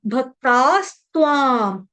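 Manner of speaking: "भक्तास्त्वां" as bhak-taas, tvaam, drawn out; broken up slowly. Shown as a demonstration. Teacher splitting the word for students, slow "भक्तास्त्वां". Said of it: "तोड़ तोड़ के पढ़ा दिया".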